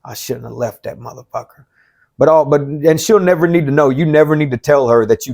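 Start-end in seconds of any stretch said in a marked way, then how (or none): 0.0-1.7 hushed whisper of regret; imitated ex whispering. Ex-wife whispering her regret. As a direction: whisper it like she realizes her mistake. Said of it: "she looks at you and is like".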